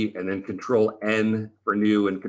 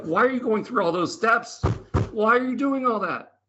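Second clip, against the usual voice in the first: high, mock-annoyed old lady voice; squeaky imitation of a scolding grandma; 13 semitones higher than usual. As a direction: play the impatient eighty-two-year-old in the front row. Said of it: "She's super annoyed with me".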